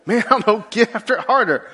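chuckling as you answer; laughing reply. Real, not acted. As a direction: laugh through the words. Joking reply while laughing.

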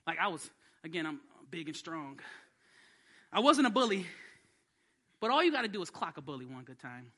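ordinary talk

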